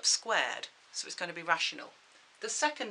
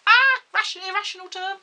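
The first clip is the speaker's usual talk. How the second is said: sudden high, loud outburst; excited exclaim; 12 semitones higher, 14 dB louder than usual. Real, not acted. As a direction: burst out with mock alarm. Teacher spots irrational term.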